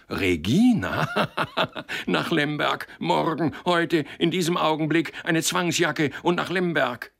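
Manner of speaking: high, hysterical ranting with a laugh after the name; agitated outburst. A narrator's reading. Boss raging at his clerk's new misdeed, agitated. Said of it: "Der erregte Mann".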